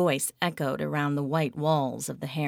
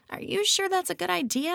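much higher, cautious friend; Lauren's voice; faster than usual. A narrator's reading. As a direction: ask warily.

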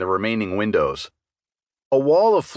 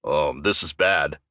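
low, slow, nervous troll; hesitant, lower troll barkeep; a worried troll, slow and low; skittish troll bartender; 6 semitones lower, slower than usual. Acted. Troll bartender Percy voiced.

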